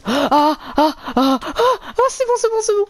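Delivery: high panicked yelps. Real, not acted. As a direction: squeal in panic while fleeing. Panicking, chased by bees.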